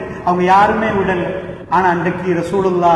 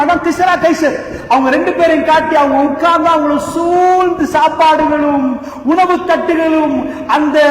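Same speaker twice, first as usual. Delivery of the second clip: high, raised preaching voice; impassioned preaching; 7 semitones higher, 3 dB louder than usual. Acted. Preacher describing the Prophet's empty house, fervent. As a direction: paint the empty house with fervour.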